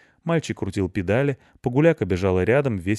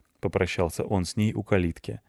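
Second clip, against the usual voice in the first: ordinary delivery, nothing marked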